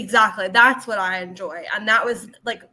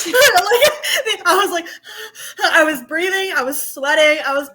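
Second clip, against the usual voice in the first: laughing; 6 semitones higher, 5 dB louder than usual. Real, not acted. Laughing while retelling.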